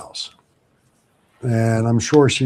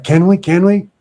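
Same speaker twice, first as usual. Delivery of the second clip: higher, eager repeated plea; eager begging; 7 semitones higher, 3 dB louder than usual. Retold or imitated. Girlfriend eagerly begging the narrator to join him. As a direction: plead like a kid asking for a treat.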